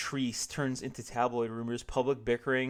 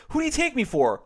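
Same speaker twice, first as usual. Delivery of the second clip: indignant; 11 semitones higher, 7 dB louder than usual. Acted. George's indignant denial.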